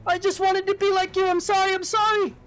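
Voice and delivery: high, frightened little-brother voice; pleading child. Acted. Stowaway brother apologises to Kel, high pleading.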